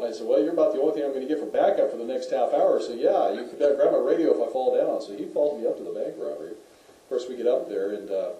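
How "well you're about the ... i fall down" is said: loud, higher wisecrack to a colleague; joking retort. Retold or imitated. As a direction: retell your own wry comeback, voice raised. Own joking reply, retold.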